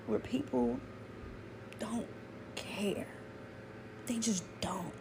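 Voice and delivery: whispered, resigned. Real, not acted. Telling viewers people don't care, whispered. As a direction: let it fall to a bare whisper. Grieving the election.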